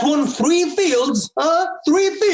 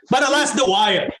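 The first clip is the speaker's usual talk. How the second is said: ordinary talk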